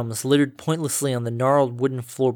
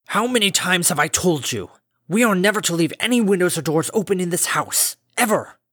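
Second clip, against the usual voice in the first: stern, raised, high voice; angry scolding; 5 semitones higher than usual. Acted. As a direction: scold her hard. Father scolds daughter at door.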